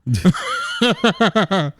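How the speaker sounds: high-pitched burst of laughter; big laugh. Real, not acted. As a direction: crack up helplessly at the story. Laughing at the crowd's reaction.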